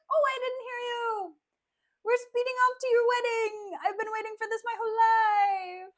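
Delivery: high, loud, gushing father voice, almost crying with joy; oblivious father. Retold or imitated. Father rushing Kate to the wedding, high and tearful with glee. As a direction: play the father too giddy to hear her. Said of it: "her father's just like".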